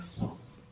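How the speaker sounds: long hissed S; sustained voiceless S, no vowel; isolated S sound. Shown as a demonstration. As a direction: blow a thin stream of air as S. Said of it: "мы как бы выдуваем эту струйку воздуха".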